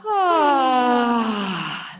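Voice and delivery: long, loud, open release sigh. Shown as a demonstration. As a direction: let the breath fall out on a big sigh. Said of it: "And maybe a little sigh".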